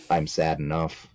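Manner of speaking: ordinary delivery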